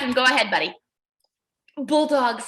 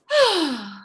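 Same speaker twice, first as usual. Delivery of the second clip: disappointed sigh; 3 semitones higher than usual. Shown as a demonstration. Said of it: "can you sigh with disappointment".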